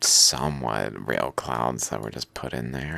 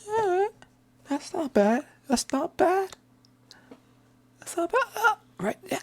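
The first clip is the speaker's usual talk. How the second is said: high, settling critter voice; calming animal voice; 5 dB louder than usual. Retold or imitated. Petted animal calming down in high voice. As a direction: let the animal relax, pitched up. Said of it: "it's like".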